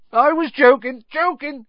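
high, alarmed backtracking; hasty. A narrator's reading. Hagrid seeing the looks on their faces, hastily backtracking. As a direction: take it back urgently at high pitch. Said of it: "he added hastily".

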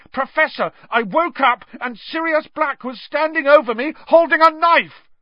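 high panicked shout, peaking at the end; yelling. A narrator's reading. Ron tells the professor about the intruder, frantic yelling. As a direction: yell your story in desperation to be believed. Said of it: "Ron yelled".

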